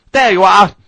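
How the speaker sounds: high, loud reproach; indignant. Acted. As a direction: scold him for being late. Mrs Parker scolding from coach.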